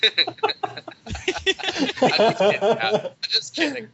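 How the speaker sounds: just kidding, through loud laughter; laughing. Real, not acted. Laughing at own joke.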